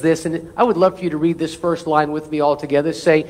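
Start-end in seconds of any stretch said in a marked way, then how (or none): none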